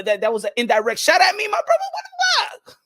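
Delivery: ordinary talk, nothing marked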